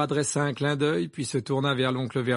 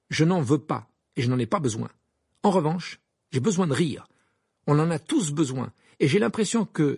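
raised, emphatic insistence; firm; 5 semitones higher, 5 dB louder than usual. Acted. Harry pressing the twins to accept, firm. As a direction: press the point firmly. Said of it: "reprit Harry d'un ton ferme".

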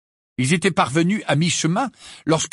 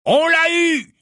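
very high, loud triumphant howl; yelling; 5 dB louder, slower than usual. A narrator's reading. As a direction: holler it to distant comrades. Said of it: "hurla le mange-mort". (hurlement aigu et puissant)

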